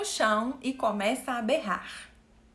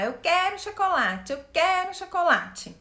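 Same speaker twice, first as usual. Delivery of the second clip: put-on higher, louder whiny child demand; childish wanting; 6 semitones higher, 3 dB louder than usual. Shown as a demonstration. Teacher showing the childish "quero chocolate" posture, higher, louder whiny demand. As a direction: whine it like a kid wanting candy. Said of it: "o querer do chocolate".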